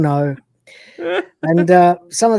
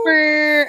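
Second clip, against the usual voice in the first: party blower noise; 9 semitones higher than usual. Retold or imitated. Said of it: "He goes".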